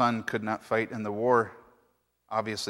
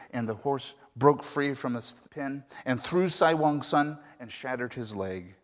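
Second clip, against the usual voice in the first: usual speaking voice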